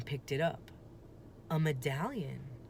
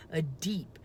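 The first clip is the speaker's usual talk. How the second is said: plain reading voice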